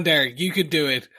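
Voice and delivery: usual speaking voice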